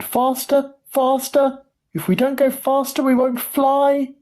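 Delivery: very high-pitched urging; urgent cry. A narrator's reading. Mister Wonka urging the lift on, urgent high cry. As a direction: cry out high, pressing for speed. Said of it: "cried Mister Wonka".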